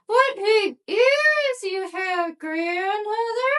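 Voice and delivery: put-on high, slow, sweet little girl. A narrator's reading. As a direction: answer innocently in a high little girl's voice. Girl marvels at the wolf.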